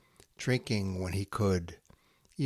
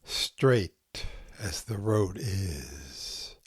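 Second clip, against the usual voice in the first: slow, hushed haiku recital with long pauses; poem reading; 3 semitones higher, slower than usual. Acted. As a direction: let each haiku settle into silence. Reads Santoka haiku aloud.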